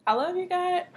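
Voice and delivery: high, loud, sneering I love you God; disdainful. Shown as a demonstration. Teacher shows a signer meaning I can't stand him, sneering. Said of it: "I can't stand him".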